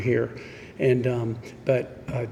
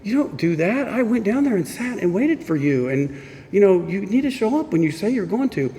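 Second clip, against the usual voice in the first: mock stern scolding, pitched much higher; retold angry scolding; 7 semitones higher than usual. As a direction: scold like a let-down sponsor. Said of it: "he's mad".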